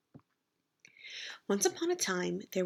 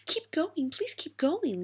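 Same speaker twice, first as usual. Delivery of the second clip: whispered plea; 8 semitones higher than usual. A narrator's reading. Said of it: "Little Ogre whispered".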